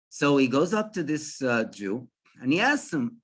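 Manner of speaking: normal talking voice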